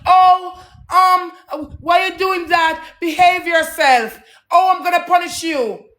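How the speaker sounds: put-on high, scolding mother telling a child off; scolding mama voice. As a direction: play the mama, shrill and stern, rising to a shout. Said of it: "Mama them".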